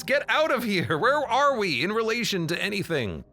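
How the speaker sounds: high, loud disbelief; baffled outcry. Real, not acted. Player baffled the cruiser landed: high loud outcry. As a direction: shout in confused disbelief. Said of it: "player baffled by landing".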